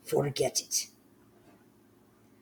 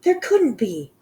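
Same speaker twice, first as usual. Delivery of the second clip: high, louder protest; shouted; 9 semitones higher, 8 dB louder than usual. Acted. The other witches shout back in disbelief. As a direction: fling the denial out, high. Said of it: "the others shouted".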